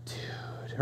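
breathy whispered dude. Retold or imitated. Friend most impressed by a steakhouse, whispering. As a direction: barely whisper it, awed. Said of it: "we've gone from".

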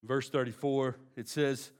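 usual speaking voice

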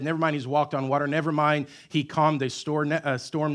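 normal talking voice

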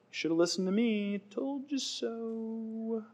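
high, soft sing-song taunt; smug told-you-so. Acted. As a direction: draw it out like a gloating singsong. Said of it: "I have a told you so story".

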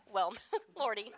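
low, soft laugh through the words; laughing at oneself. Real, not acted. Preacher laughing off a fumbled Bible reading, quietly amused. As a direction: chuckle at your own slip.

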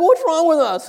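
high, loud mock sobbing question; almost crying. Preacher asks friend about their weepiness, mock crying. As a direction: wail it in comic despair. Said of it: "we're almost crying".

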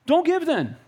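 telling a griping churchgoer to just not give, exasperated; exasperated retort. Retold or imitated. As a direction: toss it back at him, fed up. Pastor retells telling off doubter.

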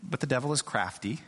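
ordinary talk

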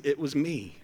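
soft, laughing little confession; half-laughed admission; coy aside. Retold or imitated. Pastor voicing John's parenthetical boast, softly laughing.

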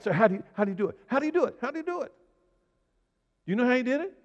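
high-pitched, insistent questions; urgent questioning. Acted. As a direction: push the pitch way up without shouting. Acting out urgent baffled questioning.

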